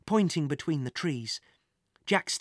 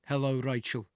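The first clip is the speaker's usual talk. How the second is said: menacing man voice; 3 semitones lower, 3 dB quieter than usual. Acted. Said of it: "said a man's voice".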